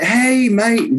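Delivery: high, hearty mock-Swedish greeting; playful Swedish-accented call. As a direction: hail them in a put-on Swedish accent. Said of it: "They're from, like, Sweden or something".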